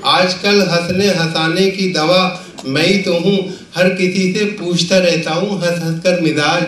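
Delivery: measured, projected Urdu verse recital; comic poet reciting with rhythmic phrasing; mushaira recitation. Acted. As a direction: declaim the couplets to a live audience, line by line.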